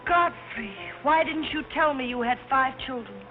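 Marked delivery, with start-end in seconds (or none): 0.1-1.9 high shocked outburst; shocked cry. Acted.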